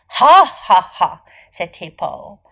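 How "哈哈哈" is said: loud, slow, spaced-out ha-ha-ha; scornful storybook laugh, each ha separate; hippo's mock laugh. A narrator's reading. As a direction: laugh loudly and deliberately at a small challenger. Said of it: "河马哈哈哈地笑了起来".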